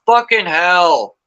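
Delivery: drawn-out Aussie swear of relief; mock Australian outburst; Australian accent. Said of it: "She got the accent like you".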